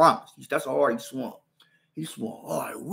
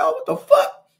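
much higher, louder shocked cry; 7 dB louder, slower than usual. Retold or imitated. Realizing a girl hits him, a high shocked cry. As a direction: react to a girl hitting you. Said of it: "I'm like".

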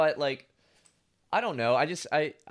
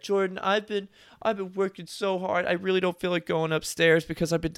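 imitating a guilt trip; 6 semitones higher than usual. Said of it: "Skylar hit me with that".

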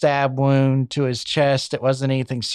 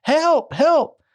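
screaming panic; 9 semitones higher than usual. Retold or imitated. Mother screaming as husband drowns their baby, high imitated panic. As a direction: climb higher into panicked cries for help. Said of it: "starts screaming and says".

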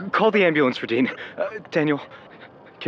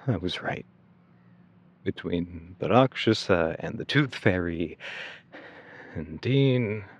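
lower, subdued reflective telling; somber narration; 7 semitones lower, 4 dB quieter than usual. Acted. Narrator recalling the night Daniel was hurt, somber. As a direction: look back on it quietly, weighed down.

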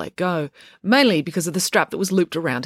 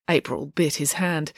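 plain reading voice

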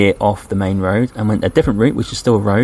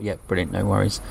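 softer, thought-to-self aside; quiet inner thought; 8 dB quieter than usual. Retold or imitated. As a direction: say it under your breath to yourself. Inner thought after a lift.

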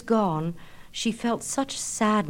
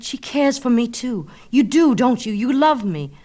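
high, loud, eager; overjoyed pleading; 6 semitones higher, 8 dB louder than usual. Acted. Narcissus pleading with the reflection, high and eager. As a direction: beg her to say yes.

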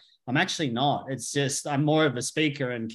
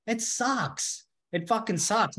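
groaning complaint; 7 semitones higher, much slower than usual. Real, not acted. Complaining about hard breath work.

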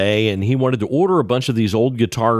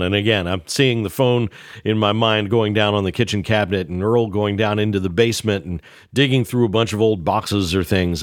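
ordinary talk, nothing marked

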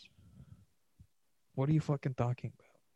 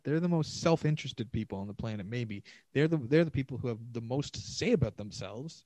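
usual speaking voice

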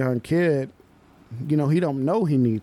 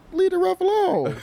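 pitched way up, firm warning; high mock stern coach; 3 dB louder than usual. Coach telling the young player to leave the ref alone, pitched high and firm.

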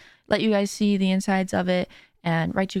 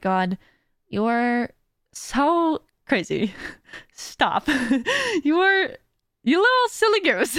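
mock-scolding through laughs, getting higher; overcome with affection; 6 semitones higher, slower than usual. Streamer gushing to her generous supporters, overcome and giggly. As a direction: pitch climbing into a high, giggly squeal. Overwhelmed by supporters, addressing them fondly.

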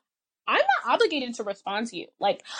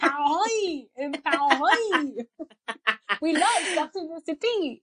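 high, drawn-out mock French accent; 4 semitones higher, slower than usual. French fans raving to the visiting writer, French accent. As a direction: stretch the words like a gushing French fan. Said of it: "I love your French accent".

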